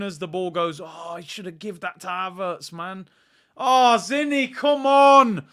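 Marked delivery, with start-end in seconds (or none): 3.6-5.5 loud, high exasperated yell at a player; frustrated shout. Real, not acted. Fan streamer yelling at Zinchenko for a sloppy pass, loud and exasperated. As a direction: vent at the misplaced pass.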